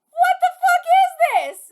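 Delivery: loud laughing outburst, pitch jumping up; shocked laugh. Real, not acted. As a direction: blurt it out half laughing in disbelief. Stunned by the match results.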